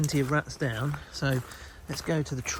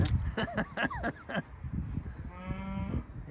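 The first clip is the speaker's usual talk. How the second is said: high run of chuckling; real chuckle; 7 semitones higher, 4 dB quieter than usual. Real, not acted. Hunter finds the rats a bit soggy, real chuckle. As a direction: chuckle away in quick high pulses.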